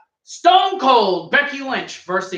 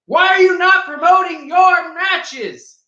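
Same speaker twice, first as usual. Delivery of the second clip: indignant rant; 11 semitones higher than usual. Real, not acted. Ranting at the promoters.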